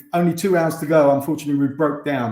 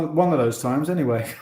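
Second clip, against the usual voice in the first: usual speaking voice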